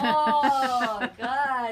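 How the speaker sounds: laughing. Real, not acted. Recalling the wild drive with Justin, laughing. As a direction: break up laughing at the memory.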